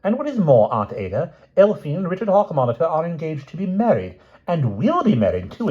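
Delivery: high, defiant, ringing announcement; young woman announcing the news to the family, loud and clear. Acted. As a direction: declare it boldly to the whole family. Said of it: "said loudly and clearly".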